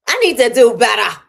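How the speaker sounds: loud, firm, self-scolding; emphatic resolve. Real, not acted. Vlogger firmly tells herself to do better, loudly. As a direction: promise yourself firmly.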